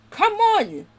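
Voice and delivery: high, loud burst of frustration; exasperated call. Real, not acted. Frustrated at pressing wrong key.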